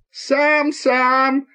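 high, wailing exclamation; tearful cry. A narrator's reading. Conductor turning on the boy, tearful cry. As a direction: wail it out, very high, on the verge of tears. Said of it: "закричала она плачущим голосом".

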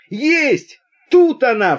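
high, loud cry of discovery; excited shout. A narrator's reading. Young craftsman spotting the viper, excited shout. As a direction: yell it out, pitch leaping up. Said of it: "вскрикнул один из ремесленников".

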